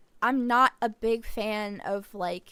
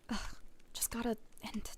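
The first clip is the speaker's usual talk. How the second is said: frustrated whisper; 12 dB quieter than usual. Acted. Frustrated muttering.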